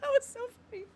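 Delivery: high, laughter-squeezed voice; helpless laughter. Real, not acted. Streamer saying that was so funny, still cracking up. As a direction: squeak it out after a laughing fit.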